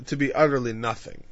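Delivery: ordinary talk, nothing marked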